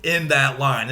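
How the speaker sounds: ordinary talk, nothing marked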